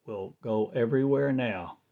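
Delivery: normal talking voice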